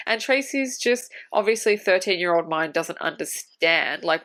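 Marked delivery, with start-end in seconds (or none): none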